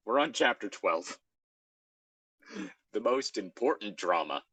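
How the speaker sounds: ordinary delivery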